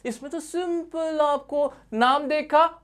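high, slow, drawn-out words; playful oversimplifying. Acted. Teacher shows students how simple it is, playful oversimplifying.

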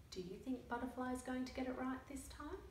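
usual speaking voice

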